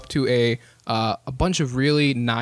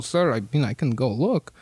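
ordinary talk

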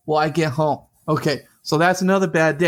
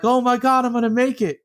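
higher-pitched retold thrill; excited disbelief; 7 semitones higher than usual. Kid flying on his scooter mid-jump, excited disbelief. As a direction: think you're actually pulling it off.

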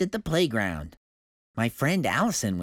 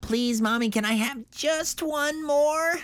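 put-on a girl begging Mommy after her nap, pleading. A narrator's reading. Little girl asking Mommy for one more, begging. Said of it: "I begged".

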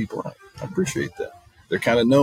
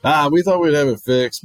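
mechanic excuse; 8 semitones higher, 7 dB louder than usual. Retold or imitated. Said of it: "I said".

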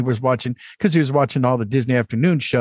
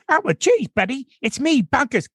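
much higher, zany cartoon bobcat voice; high goofy cartoon bobcat greeting; Bonkers D Bobcat; 11 semitones higher than usual. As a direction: bounce into a manic toon cat. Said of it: "you should do the voice of Bonkers D Bobcat".

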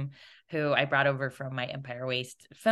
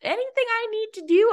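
high, breathy nervous voice; high-pitched fretful check-in; mimicking anxious producer; slower than usual. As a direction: squeak it out like a worried producer. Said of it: "being like".

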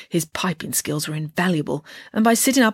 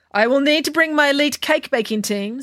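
shrewd; 8 semitones higher than usual. Acted. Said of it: "said Nanny Piggins shrewdly".

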